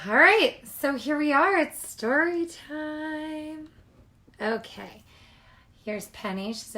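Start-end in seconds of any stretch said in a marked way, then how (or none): 0.1-3.7 excited. Real, not acted. Teacher welcoming kids to story time, excited. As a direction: announce it like a treat.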